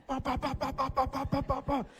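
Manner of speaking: high quick ba-ba-ba punches; rapid punch noises. Retold or imitated. Swinging wildly at the big guy, high punch sounds. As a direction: mimic flailing punches fast. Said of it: "I started swinging as fast as I can".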